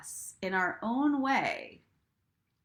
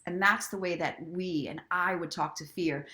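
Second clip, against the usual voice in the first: usual speaking voice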